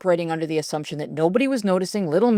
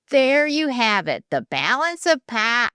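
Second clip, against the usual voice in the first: teacher voice; 6 semitones higher than usual. A narrator's reading. Teacher addressing class after film.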